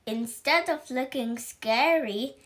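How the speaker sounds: plain reading voice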